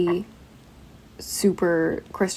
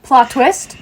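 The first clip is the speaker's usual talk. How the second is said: louder, higher laughing mimicry; imitating co-host; 4 semitones higher, 8 dB louder than usual. Host mimicking Jamie's plot twist, hammed-up and laughing. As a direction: mimic her line back, exaggerated, through laughter. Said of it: "Jamie went".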